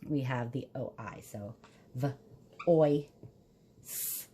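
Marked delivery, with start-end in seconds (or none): 1.9-4.3 "v, oi, s" as three separate sounds with pauses; sounded out. Shown as a demonstration. Teacher spelling voice for children, v, oi, s sounded out separately. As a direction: sound out v, oi, s one by one. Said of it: "if we say that slowly".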